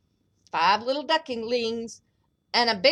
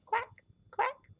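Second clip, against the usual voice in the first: higher, softer duck quacks; 13 semitones higher, 5 dB quieter than usual. Acted. Duck sound after story.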